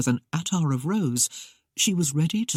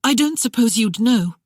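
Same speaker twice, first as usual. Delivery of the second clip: put-on higher, louder anxious young bride; anxious rush; 7 semitones higher, 5 dB louder than usual. Bride asking the housekeeper about her husband, anxious and rushed. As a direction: rush it out worried. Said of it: "in an anxious rush".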